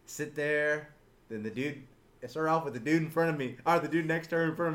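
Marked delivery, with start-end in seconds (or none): none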